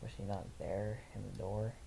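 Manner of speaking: usual speaking voice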